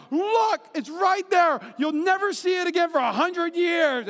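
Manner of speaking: high, hammed-up cries; imitated urgent pointing. Someone urging another to see it, mock excited. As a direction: push it up high as if it's vanishing.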